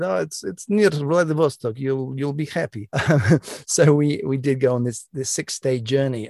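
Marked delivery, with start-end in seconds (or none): 0.0-2.9 put-on light Russian accent, a touch higher; lightly voiced Russian minder in a Russian accent, warning; Russian KGB minder. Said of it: "And he said".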